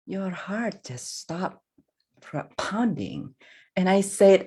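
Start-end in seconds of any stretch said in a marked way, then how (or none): none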